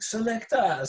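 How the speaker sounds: put-on high Jamaican MC shout, Jamaican accent; fake Jamaican accent. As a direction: toast it like a Jamaican selector in a Jamaican accent. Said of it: "the fake, uh, Jamaican accent".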